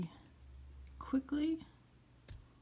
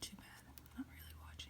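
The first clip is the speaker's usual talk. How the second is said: hushed aside, fading almost to nothing; whispering; 21 dB quieter than usual. Real, not acted. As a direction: let it sink into a near-silent whisper. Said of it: "why am I whispering?".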